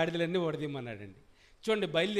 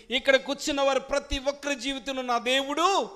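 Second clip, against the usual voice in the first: loud, higher, ringing preacher's voice; fiery preaching; 7 semitones higher, 7 dB louder than usual. Acted. Pastor proclaims to the congregation, full-force preaching. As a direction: proclaim God's miracle to the hall.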